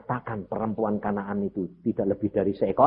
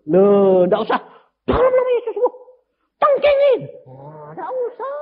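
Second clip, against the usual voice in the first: mocking a healer; 9 dB louder than usual. Mock charismatic healer.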